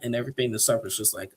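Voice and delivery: ordinary delivery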